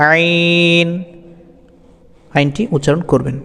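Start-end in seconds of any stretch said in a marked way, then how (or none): 0.1-0.9 আইন from mid-throat on a held note; mid-throat sound. Shown as a demonstration. Teacher repeating for learners, throaty stretched আইন. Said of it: "এভাবে".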